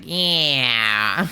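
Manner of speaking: cartoon cat accent imitation; Meowth accent. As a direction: drawl it like the Team Rocket cat. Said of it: "his accent".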